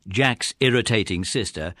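ordinary delivery

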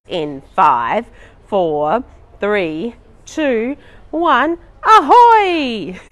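countdown climbing to a loud ahoy; building countdown. Pretend. Counting down within the song.

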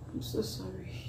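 soft, drained apology; real tearful apology. Real, not acted. Reactor apologizing to viewers for crying on camera, softly tearful. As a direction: apologize quietly after crying. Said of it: "I could not hold back the tears".

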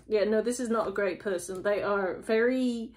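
ordinary talk, nothing marked

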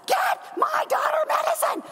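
high, shrieking movie-mother demand; frantic Shirley MacLaine. Retold or imitated. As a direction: scream it like a desperate mother. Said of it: "Shirley MacLaine went crazy".

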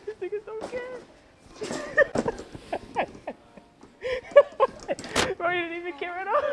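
high, breathless laughter through the words; laughing. Real, not acted. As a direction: wheeze out the words between laughs. Laughing at indifferent coworker.

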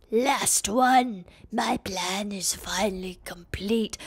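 hushed, low villain whisper; scheming villain. Acted. Villain Skeletox reveals his plan, in a sinister whisper. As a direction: whisper it slowly, dropping away.

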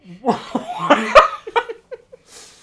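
high, loud cackle; loud laugh. Real, not acted. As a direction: crack up hard. Mister Staircase joke.

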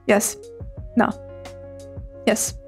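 ordinary delivery

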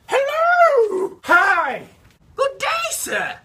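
cheery greeting. Acted. Waving with feet, cheery.

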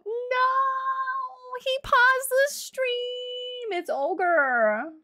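high, long-drawn mock wail; mock despair. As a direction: wail it as if all is lost, jokingly. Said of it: "Just kidding".

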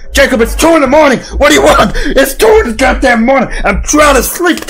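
high, mock-annoyed protest; hammed-up exasperation; exasperated complaint. Acts woken neighbor complaining.

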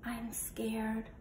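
hushed, frightened whisper; scared whisper. Acted. Nervous puppy confessing fear to the falcon in a whisper. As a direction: breathe it out, afraid to be heard. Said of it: "he whispered".

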